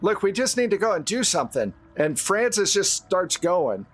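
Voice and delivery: ordinary delivery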